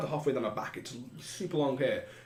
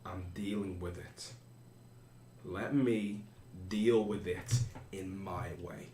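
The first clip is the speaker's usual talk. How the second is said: much softer, lower and slow; calm low reply; 5 semitones lower, 6 dB quieter, slower than usual. Acted. Ulf answering the angry woman, low slow calm. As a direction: keep it down, give nothing away.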